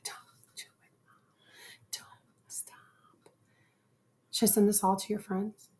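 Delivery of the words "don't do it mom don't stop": whispered daughter pleading; mock daughter whisper. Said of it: "She's like".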